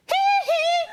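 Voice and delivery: very high falsetto hee hee giggle; Michael's giggle. Retold or imitated. Michael laughing loudly in the theater, high falsetto giggle. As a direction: squeal a little giggle. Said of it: "watching the movie like".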